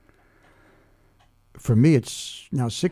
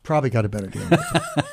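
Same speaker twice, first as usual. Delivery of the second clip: laughing; faster than usual. Real, not acted. Laughs while repeating friend's quip.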